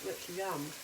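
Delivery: normal talking voice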